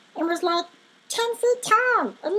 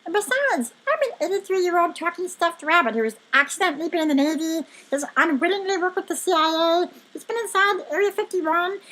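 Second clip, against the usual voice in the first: pitched-up, insistent self-defence; defensive outburst; 3 semitones higher than usual. Acted. Rabbit defending himself to an interrupting friend, defensive and insistent. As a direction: rattle off your credentials indignantly.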